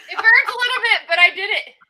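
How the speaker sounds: laughing, loud and strained; laughing through the sting. Real, not acted. Just shotgunned a beer, laughing.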